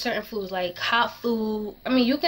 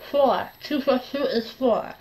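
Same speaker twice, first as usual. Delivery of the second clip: tongue piercing; 2 semitones higher, slower than usual. Shown as a demonstration. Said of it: "it's kind of really weird".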